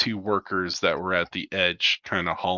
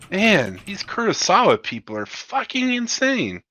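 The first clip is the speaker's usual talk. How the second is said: much higher, stunned complaint; outraged crew member; 10 semitones higher than usual. Acted. Hangar crewman marvels at the corpo pilots, high incredulous voice. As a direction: rant like a rattled dockhand. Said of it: "hangar bay go".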